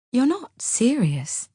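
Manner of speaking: slow, stunned disbelief; very slow shocked murmur; slowly. A narrator's reading. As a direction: draw it out, not believing it. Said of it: "I say slowly".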